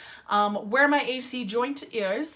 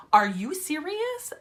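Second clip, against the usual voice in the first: higher, rising indignant question; mock outrage, pitched up at the end; retold mad disbelief; 6 semitones higher than usual. Patient retelling her reaction to the doctor, indignant disbelief. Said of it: "I was so mad when she told me that".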